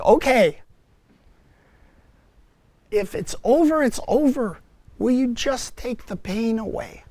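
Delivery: giving up and begging; desperate plea. Retold or imitated. Man surrendering to Mary and begging relief, desperate.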